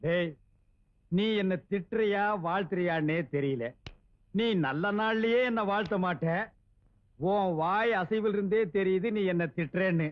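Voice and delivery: very low-pitched, measured reply; suspicious grumble. Acted. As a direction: answer the taunter deep in the voice. Deaf man answers the taunts. (தாழ்ந்த குரலில் சந்தேகப் புலம்பல்)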